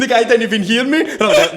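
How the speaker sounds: laughing. Real, not acted. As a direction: giggle the words out. Laughing while telling.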